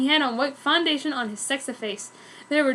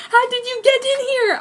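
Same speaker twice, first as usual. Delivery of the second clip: much higher, louder demand; shocked anger; 11 semitones higher, 6 dB louder than usual. Acted. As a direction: confront the intruder in shock. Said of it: "I asked shockingly with anger".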